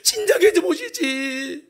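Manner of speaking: high, whimpering mock lament; tearful reproach. Grieving sister reproaches Jesus for coming late, tearful reproach. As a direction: whine a held-back grievance, drawing out the end. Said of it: "막 원망스럽지만 원망할 순 없고".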